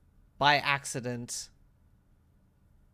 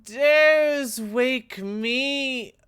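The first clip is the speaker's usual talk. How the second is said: high, loud, slow booming spirit voice; booming creature voice; 13 semitones higher, 9 dB louder, much slower than usual. Acted. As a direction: roar it like a woken spirit. Statue being awakens, booming.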